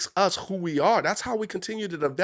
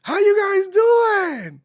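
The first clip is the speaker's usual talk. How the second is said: high, slow, drawn-out shout to the crowd; host greeting the gala crowd, shouting; big MC shout; 8 dB louder, much slower than usual. Retold or imitated. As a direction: belt it out like a hype emcee. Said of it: "First words came out".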